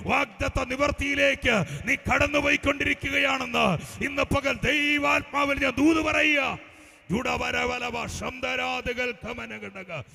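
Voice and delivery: high, loud, relentless preacher's delivery; full-force prophetic preaching. Real, not acted. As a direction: proclaim it over the congregation, building to a shout. (അത്യാവേശത്തോടെ ഉച്ചത്തിൽ പ്രവചന പ്രസംഗം)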